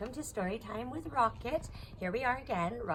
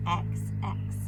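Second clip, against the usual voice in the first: deep voice; 3 dB louder than usual. Acted. Very low letter chant.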